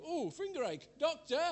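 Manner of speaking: high, hammed-up fretting; mock fuss. Preacher mocks himself running to the doctor, high and fussy. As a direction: squeak it out like a worrier. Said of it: "I go".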